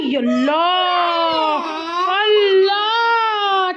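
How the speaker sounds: long, drawn-out cry to heaven; stretched cry of dismay; exasperated wail. Real, not acted. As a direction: wail it out slowly and loudly. Mother exclaims at children's mischief.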